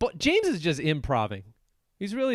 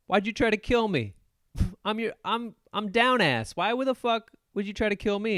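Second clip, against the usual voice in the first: mock indignant; 6 semitones higher than usual. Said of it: "she's like".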